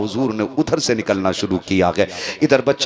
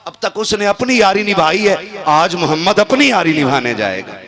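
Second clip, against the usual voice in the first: louder and higher, ringing out; raised proclamation; 7 semitones higher, 6 dB louder than usual. Acted. Preacher voicing the Prophet's words to companions, raised and ringing. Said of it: "repeated line, raised".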